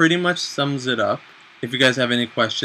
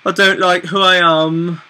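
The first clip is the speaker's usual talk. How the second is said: put-on slow, louder, higher British accent; 5 semitones higher, 5 dB louder, slower than usual. Joking to his wife on camera in a mock British accent. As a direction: ham up a gloomy British confession, slowly. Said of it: "I don't know why I just went into a British accent there".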